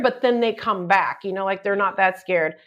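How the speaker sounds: normal talking voice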